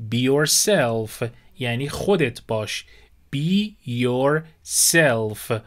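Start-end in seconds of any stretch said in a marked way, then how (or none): 3.3-5.5 very slow, both words stretched; slow model phrase. Shown as a demonstration. Teacher modelling be yourself for learners, very slow. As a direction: say it slowly so they can copy. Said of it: "یعنی نیازی نیست".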